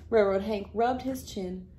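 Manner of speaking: ordinary delivery, nothing marked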